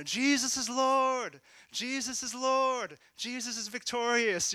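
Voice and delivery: high, drawn-out shout of proclamation; imitated group shout. Students declaring in the middle of campus, re-enacted full-lunged shout. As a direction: declare it at the top of your lungs. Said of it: "we all just start shouting at the top of our lungs".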